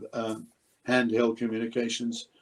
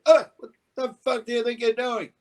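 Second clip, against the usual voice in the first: high, louder angry snap at the crew; exasperated outburst; 11 semitones higher, 4 dB louder than usual. Retold or imitated. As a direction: let the frustration burst out. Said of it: "stop and say".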